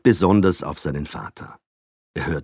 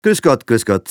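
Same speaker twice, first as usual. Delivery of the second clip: polite greeting; 8 semitones higher, 9 dB louder than usual. A narrator's reading. Father greets neighbour politely.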